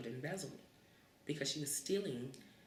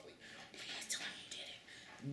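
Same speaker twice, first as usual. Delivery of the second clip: slow, whispered desperate plea; whispered begging; 9 dB quieter than usual. Retold or imitated. Cousin begging the kid to take the blame, whispered. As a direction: beg under your breath. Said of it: "Begging me".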